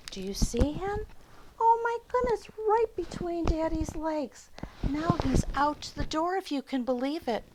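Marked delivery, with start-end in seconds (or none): 1.6-7.4 high, bursting oh my goodness; hugely surprised, pitched way up; amazed. Real, not acted. Excited reader points at picture.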